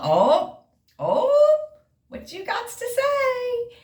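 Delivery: mock eager squeal. Mock eager question to doctor.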